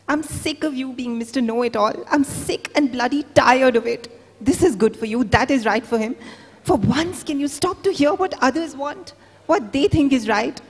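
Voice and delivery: wife snapping at her husband in the hospital room; angry outburst. Acted. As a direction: tear into him for always knowing best.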